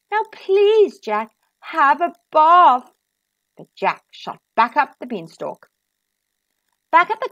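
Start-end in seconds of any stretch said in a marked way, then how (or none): 0.1-2.9 louder, higher, drawn-out plea; imploring storybook mother; pleading mum. A narrator's reading. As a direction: beg him, stretching out the last word. Mum begs Jack to wash.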